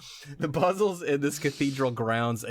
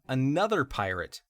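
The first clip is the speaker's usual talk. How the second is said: ordinary talk, nothing marked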